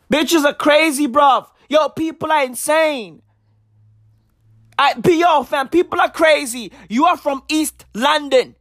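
ordinary talk, nothing marked

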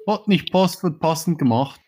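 high, loud triumphant quip. Real, not acted. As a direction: crow it out in triumph. Triumphant quip, sheet fits.

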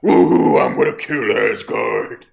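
very loud, booming giant roar; giant fire monster. Retold or imitated. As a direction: roar a mock threat as a huge fire demon. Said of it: "the giant fire guy just pops up".

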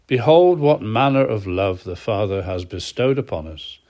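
ordinary delivery

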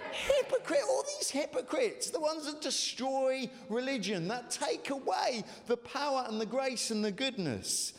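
fervent preaching call; shouted with feeling. Real, not acted. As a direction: spit out the word like you mean it. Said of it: "say hypocrites like you mean it".

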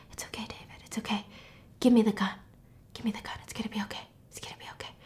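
soft, reassuring whisper; soothing whisper. Retold or imitated. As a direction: coax the gun away gently. Pruitt soothing David with the gun.

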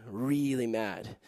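"really" stretched out slow and low, "mad" dropping soft; slow, low, hushed "really mad"; drawn-out emphasis. Real, not acted. Preacher confessing to the congregation he lost his temper, drawn-out emphasis. Repeats it slower and lower.

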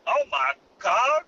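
loud high squeaky outrage; high mock outrage. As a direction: yell it in a squeaky voice.

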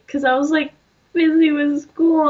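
usual speaking voice

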